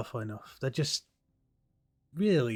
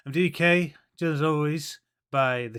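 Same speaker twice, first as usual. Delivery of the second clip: show host intro; slower than usual. Acted. Opening the show.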